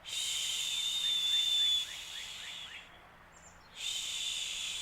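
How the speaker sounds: soft breathy shush of the wind; whispered shh. A narrator's reading. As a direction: hush it out like wind in the leaves. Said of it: "It whispers".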